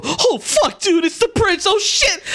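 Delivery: very high, alarmed outburst; squeaky, hammed-up dread; panicked bandits. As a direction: blurt it out in awed panic. Bandits spot the prince, panic.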